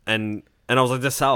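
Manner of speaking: normal talking voice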